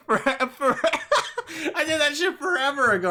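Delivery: high giggly voice; laughing. Real, not acted. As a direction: laugh through the words. Laughing at himself.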